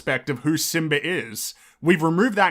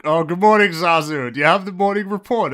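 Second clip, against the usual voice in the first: imitated weary exasperation, higher and louder; exasperated; 4 semitones higher, 5 dB louder than usual. Mufasa greeting Zazu in the remake, mock exasperated. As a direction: sigh it out like he can't be bothered, rushing the question. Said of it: "in an exasperated tone".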